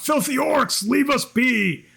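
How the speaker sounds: high, slow call from inside. Acted. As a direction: shout it out through the door. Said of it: "one voice just call out".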